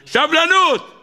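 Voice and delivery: high-pitched cry on סבלנות; exhorting cry. Acted. Preacher urging his listeners to have patience, exhorting cry. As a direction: cry out סבלנות high and drawn, falling at the end.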